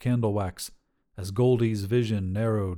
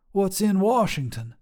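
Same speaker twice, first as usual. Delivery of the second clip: higher, bold trucker woman voice; tough lady trucker; 9 semitones higher than usual. Acted. Trucker Sharon sizing up a hitchhiker, brash and higher. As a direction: call out like a brash long-haul trucker.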